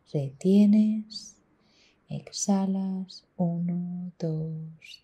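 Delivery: slow, hushed counting with long vowels; soft, drawn-out meditation voice; guided breathing count. Acted.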